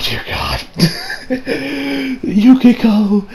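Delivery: ordinary delivery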